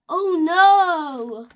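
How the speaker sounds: loud panicked cry; alarmed. Acted. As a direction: burst out in alarm. Piggy alarmed.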